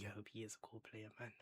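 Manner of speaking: soft unvoiced wish; whispered hope. Real, not acted. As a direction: breathe the words as a quiet hope. Repeats hope for the player.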